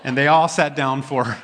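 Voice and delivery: chuckling through the words; real laughter breaking through the sentence; laughing. Real, not acted. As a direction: laugh at your own mistake. Laughs at his own slip.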